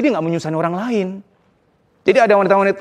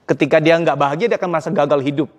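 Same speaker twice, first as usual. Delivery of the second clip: ordinary talk, nothing marked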